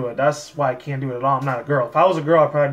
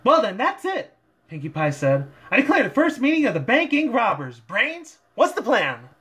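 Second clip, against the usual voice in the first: high, bouncy, excited pony voice; bubbly party pony bursting with energy; high and hyped, pitched way up; hyped-up Pinkie Pie; 8 semitones higher than usual. Acted. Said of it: "Pinkie Pie tried to bring a lot of her, like, hypeness".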